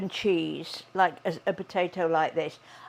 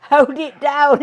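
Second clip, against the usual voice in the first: loud repeat; 7 semitones higher, 12 dB louder than usual. Retold or imitated. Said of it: "he says".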